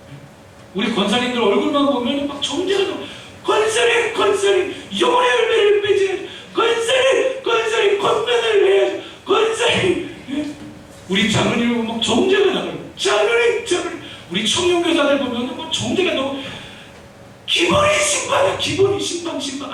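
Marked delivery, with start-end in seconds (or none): 3.4-10.2 high, loud pleading call; high urgent calling. Acted. Pastor calling out to deaconesses. 13.0-14.0 calling out. Acted. Calling out to the elder. 17.5-19.7 exclaiming. Real, not acted. Exclaiming about youth teachers.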